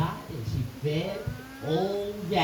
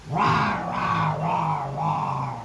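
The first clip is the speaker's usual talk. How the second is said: growling monster; much slower than usual. Acted. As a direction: growl like a giant eating. Giant gobbling stew.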